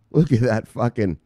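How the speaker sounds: normal talking voice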